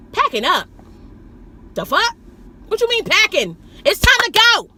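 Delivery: mock-outraged driver hurrying a passenger; indignant outburst. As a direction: build from disbelief to a high, exasperated yell. Acted outraged reply to a stalling passenger.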